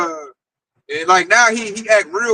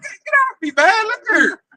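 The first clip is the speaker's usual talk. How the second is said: much higher, fed-up get-off-me; exasperated brush-off; 10 semitones higher than usual. Retold or imitated. Telling the clinging coworker to get off, much higher. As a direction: shove him off, exasperated and high. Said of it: "I'm like".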